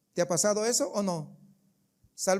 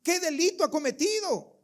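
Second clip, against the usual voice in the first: Pilate asks; 7 semitones higher than usual. Acted. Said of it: "Pilato dice".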